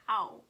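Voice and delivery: loud retold "ow"; pained ow. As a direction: yelp "ow" as if suddenly hurt. Retells being hurt in exam.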